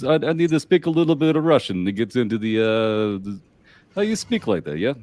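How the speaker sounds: high, loud Russian-accented English. Shown as a demonstration. Player throwing out a sample, Russian accent. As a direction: put on a lifted Russian accent. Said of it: "get into this".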